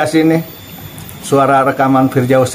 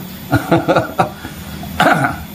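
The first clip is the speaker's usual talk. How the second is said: mocking snicker; 4 semitones higher, 5 dB quieter than usual. Real, not acted. Mocking the mubahalah claim.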